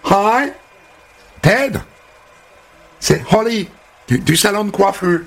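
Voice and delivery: high, eager American hairdresser selling him; bubbly phone pitch. Acted. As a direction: talk him up to Ted on the phone. Holly appelle Ted au téléphone. (coiffeuse survoltée au téléphone, voix très haute)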